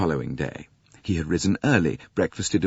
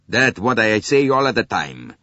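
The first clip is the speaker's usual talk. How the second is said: put-on Italian accent; 4 semitones higher, 6 dB louder than usual. Italian agrees loudly.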